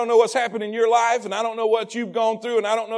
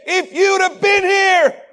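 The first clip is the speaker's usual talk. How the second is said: higher and slow, hurt plea with a catch; grieving reproach; 8 semitones higher, 7 dB louder, slower than usual. Acted. Martha to Jesus after her brother died, hurt and pleading. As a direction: let the loss pull every word slow. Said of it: "she came to Jesus and said".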